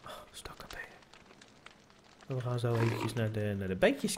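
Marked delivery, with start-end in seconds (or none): 0.1-0.9 soft unvoiced whisper. Real, not acted. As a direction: breathe it out in a hush. Whispered aside.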